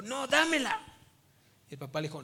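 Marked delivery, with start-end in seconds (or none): 0.0-1.0 put-on high, loud childish demand, no dámela; a small girl grabbing for the bag; little girl demanding. Little girl snatching her mother's bag back from dad, high loud whine.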